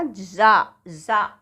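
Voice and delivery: "जा" said as za, drawn out slowly; buzzed z sound. Shown as a demonstration. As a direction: demonstrate buzzing za for "जा". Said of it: "एक और sound निकलता है". ("जा" को धीरे ज़ा बोलें)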